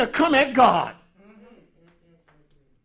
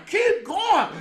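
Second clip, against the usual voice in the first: self urging; 11 semitones higher than usual. Retold or imitated. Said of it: "But I said".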